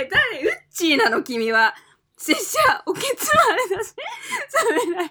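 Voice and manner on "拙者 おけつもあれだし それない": high-pitched giggling read; can't stop laughing. Real, not acted. Reading letter, cracking up.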